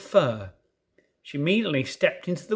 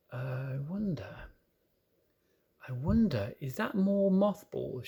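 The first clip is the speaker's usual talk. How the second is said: musing thought; 3 semitones lower, 8 dB quieter than usual. A narrator's reading. Lucy puzzling over crunching underfoot, soft musing. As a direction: muse quietly to herself. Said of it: "she thought".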